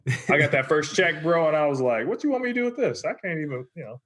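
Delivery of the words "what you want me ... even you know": high-pitched mock protest; retold incredulous complaint. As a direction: protest a tiny paycheck in a high voice. Retold protest at tiny first paycheck.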